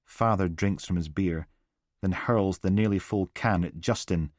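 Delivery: ordinary delivery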